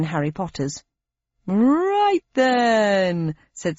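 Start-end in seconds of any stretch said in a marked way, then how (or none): 1.5-3.4 slow, higher showy announcer; game show host voice. Acted. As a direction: introduce the round with TV flair. Host sends her off.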